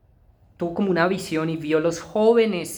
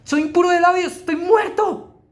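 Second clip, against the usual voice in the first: terrified shout; 10 semitones higher, 5 dB louder than usual. Acted. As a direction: scream it out as a doomed man. Isaiah's terror acted again.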